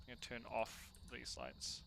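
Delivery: ordinary delivery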